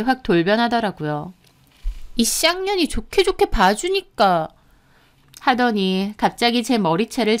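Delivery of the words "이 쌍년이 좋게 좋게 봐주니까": high, furious outburst; seething rage pitched up high; enraged cursing. Acted. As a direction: curse them as you lose your temper. Enraged before grabbing hair.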